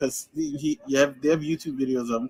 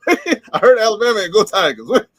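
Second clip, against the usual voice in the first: high, loud, laughing disbelief; laughing bafflement; 7 semitones higher, 9 dB louder than usual. Retold or imitated. Listener baffled by the coach, laughing loudly. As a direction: crack up while acting confused.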